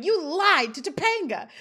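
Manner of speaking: hammed-up dramatic accusation, pitched high; Sean. A narrator's reading. Sean repeats his charge against his friend, dramatic accusation. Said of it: "he's so dramatic in this moment".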